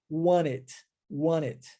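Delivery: "want it" as a low "wan-it"; American dropped T. Shown as a demonstration. Teacher models the American pronunciation, T dropped. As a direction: link "want it" low, leaving out the first T. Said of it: "a omissão do T".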